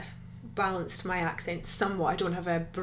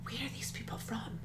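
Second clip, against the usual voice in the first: whispering; 3 semitones higher, 12 dB quieter than usual. Retold or imitated. Husband's whispered question, retold.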